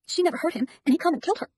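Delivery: high, choked accusation on the verge of tears; a small boy about to burst into crying; tearful boy. Acted. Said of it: "He begins to cry".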